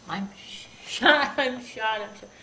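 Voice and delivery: loud cry with a laugh breaking in; retold yell. Wounded boy on the ground crying out, retold yell. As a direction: cry it out, half laughing at the memory. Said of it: "and he yelled".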